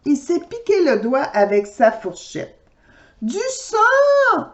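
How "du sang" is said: high shrill-free mock cry of alarm; a hammed-up mother's shriek at blood; mother panics at the blood, high mock cry. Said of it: "hurle la maman de Laurie".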